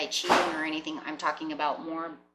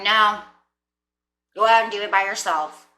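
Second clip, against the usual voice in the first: louder, brushing the partner off; dismissive partner; 3 semitones higher, 9 dB louder than usual. Acted. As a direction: push them away with a loud no. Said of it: "this person's going".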